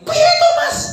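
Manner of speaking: acted wife's snappish high-pitched question; high-pitched retort. Wife challenging her husband, high-pitched retort. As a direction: jump way up high, nagging at husband. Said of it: "juga jawab dengan nada yang sama".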